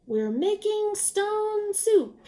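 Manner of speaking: high, very slow, savoured declaration; grand announcement. A narrator's reading. Traveler tossing in the stone, proudly slow and high. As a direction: stretch it out like a magic trick. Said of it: "he said".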